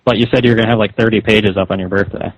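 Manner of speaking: ordinary delivery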